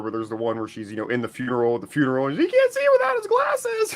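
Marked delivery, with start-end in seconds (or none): 1.9-4.0 high tearful squeal; choked-up high squeal. Real, not acted. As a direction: voice jumps way up, half laughing half crying. Laughing-crying recalling sad scene.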